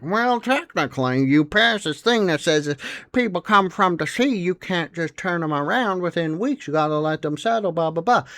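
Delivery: a mock pedant quoting the fine print; nerdy know-it-all. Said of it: "You can't be like".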